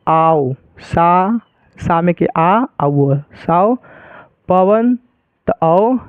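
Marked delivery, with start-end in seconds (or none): 0.1-1.4 आओ and सा held long, lower; drawn-out sounds. Shown as a demonstration. Teacher catching the sound from the start, slow drawn-out demonstration. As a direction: sound out आओ, then सा, very slowly. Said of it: "शुरुआत से उच्चारण पकड़ना है".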